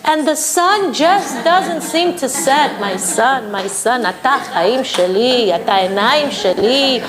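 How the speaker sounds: slow, sweet doting mother, rising high on matok; gentlest tone. Retold or imitated. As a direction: coo at him like a doting mother. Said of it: "in the gentlest tone".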